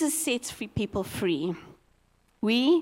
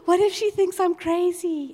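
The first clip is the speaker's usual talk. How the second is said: anxious worry; 6 semitones higher than usual. Retold or imitated. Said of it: "And then I went".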